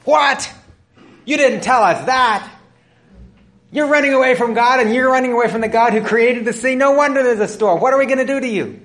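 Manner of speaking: high, loud panicked cry of the sailors; alarmed sailors. Acted. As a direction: shout it in a panic, voice pitched high.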